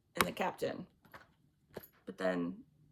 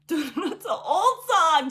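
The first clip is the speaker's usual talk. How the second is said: laughing; 6 semitones higher, 9 dB louder, much slower than usual. Real, not acted. Laughing at the song memory.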